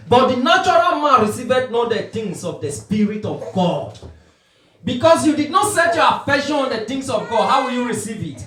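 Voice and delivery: loud, insistent scripture and rebuke; forceful preaching. Real, not acted. Preacher rebuking those not set on God's things, forceful preaching. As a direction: drive the verse home hard.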